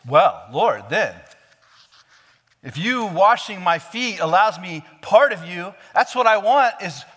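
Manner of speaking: overeager, swinging to all or nothing; eager Peter. Retold or imitated. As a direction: Peter suddenly wanting a full bath, rising to an excited high pitch. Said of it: "Peter, still in character".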